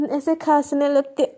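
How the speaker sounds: ordinary delivery, nothing marked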